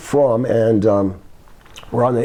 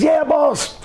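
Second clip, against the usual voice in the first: much higher, eager-to-answer voice; Coco; 13 semitones higher than usual. Retold or imitated. Coco answering his boss, high-pitched imitation. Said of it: "He says".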